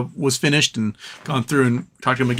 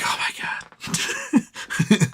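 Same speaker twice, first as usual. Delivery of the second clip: breathless, higher whispered oh my God, very slow; stunned whisper; 3 dB quieter, much slower than usual. Retold or imitated. Interviewer reacting to the ruined florist, stunned whisper. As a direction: gasp it out in disbelief, barely voiced. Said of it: "He's like".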